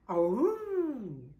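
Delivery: howling like a sad basset hound; dog howl. Acted. Reading to children, George the dog howls. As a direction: throw back your head and howl aroo. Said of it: "cried George".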